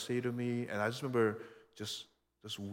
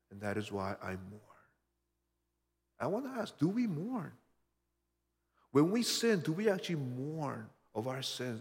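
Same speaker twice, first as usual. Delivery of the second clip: ordinary talk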